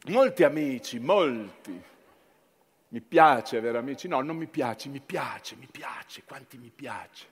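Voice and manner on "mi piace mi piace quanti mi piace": fading to a murmur. Acted. Repeats fading to nothing.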